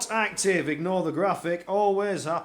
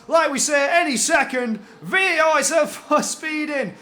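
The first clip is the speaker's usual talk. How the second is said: excited; 6 semitones higher, 4 dB louder than usual. Real, not acted. Race restart, speeding penalty.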